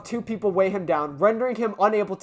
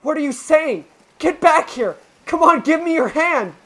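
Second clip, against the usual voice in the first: urgent pleading call; 8 semitones higher, 7 dB louder than usual. Acted. Yagumo urging Hitoa.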